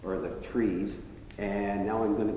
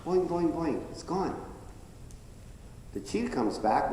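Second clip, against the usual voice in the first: usual speaking voice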